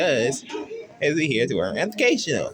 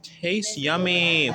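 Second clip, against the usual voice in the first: usual speaking voice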